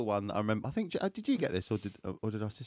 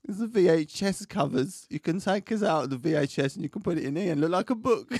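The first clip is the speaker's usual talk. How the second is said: comic Italian accent impression; 4 semitones higher than usual. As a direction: play an Italian auntie explaining a present. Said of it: "she's gonna be Italian now".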